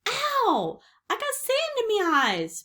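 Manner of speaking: high, loud pirate whine; whining. A narrator's reading. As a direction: complain in a high wail of pain. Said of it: "Pinky whined".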